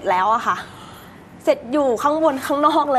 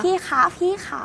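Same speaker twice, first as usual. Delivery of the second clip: sweet, coaxing "พี่คะ พี่ขา", pitched up; honeyed voice; 6 semitones higher than usual. Shown as a demonstration. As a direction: coo it sweetly to win him over. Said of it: "ทำเสียงหวานๆ".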